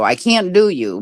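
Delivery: usual speaking voice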